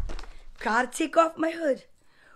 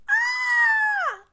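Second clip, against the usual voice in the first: very high drawn-out squeal; horrified shriek; 4 dB louder than usual. A narrator's reading. Boy hearing his toys must go, high horrified squeal. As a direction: shriek in cartoon horror.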